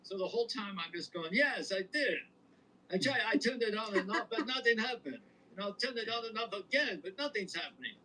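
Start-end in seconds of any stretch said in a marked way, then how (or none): 1.3-7.9 put-on a higher old-timey movie star voice; Cary Grant voice. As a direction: play a hint of a classic Hollywood leading man on the phone. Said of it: "they don't know who Cary Grant is".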